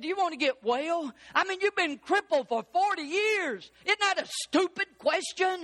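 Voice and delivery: high-pitched preacher's incredulity; incredulous challenge. Acted. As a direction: ask it as an outraged, baffled retort. Acts out an incredulous challenge to Jesus.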